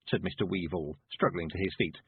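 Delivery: ordinary delivery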